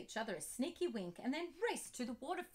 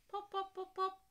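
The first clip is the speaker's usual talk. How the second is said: playful popping; 8 semitones higher than usual. Acted. Bubbles popping sound play.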